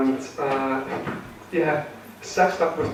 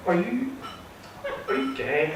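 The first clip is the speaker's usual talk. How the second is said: tentative, high-pitched retold question; mock delicate inquiry, higher at first; imitating a woman's question; 8 semitones higher, 5 dB quieter than usual. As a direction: ask it haltingly, pitch lifting on "you". Acted question from woman at bar.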